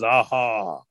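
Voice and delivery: low, menacing bear; accusing bear. Acted. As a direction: drop low, you've caught them. Bear confronts the Cherokees.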